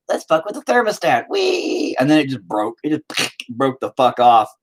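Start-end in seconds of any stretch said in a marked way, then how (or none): 0.1-2.0 playful high voice, ending in a very high gleeful squeal; a naughty little troublemaker squealing wee; mischievous eggs. Pretend. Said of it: "eggs in there just like".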